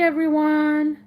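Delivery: ordinary delivery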